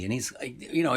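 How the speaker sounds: normal talking voice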